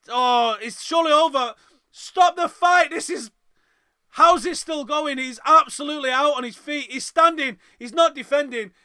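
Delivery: high, loud pleading yell; frantic shouting. Real, not acted. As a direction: scream for the ref to stop it. Fighter hurt, begging stoppage.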